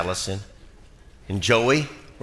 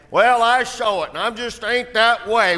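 mock reluctant father; 11 semitones higher, 3 dB louder than usual. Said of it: "excuse of a father".

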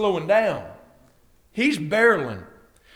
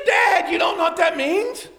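incredulous retort; 9 semitones higher than usual. Retold or imitated. Acted son's incredulous retort to dad.

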